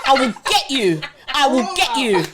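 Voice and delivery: loud, laughing threat; hammed-up warning, half laughing; mock threat.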